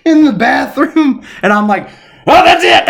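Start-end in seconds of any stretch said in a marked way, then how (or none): 2.3-2.8 high, loud, half-laughing exclamation; mock outburst. Storyteller deciding to run down there, mock outburst. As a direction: burst out with it, playing up the last straw. Retelling his snap into action.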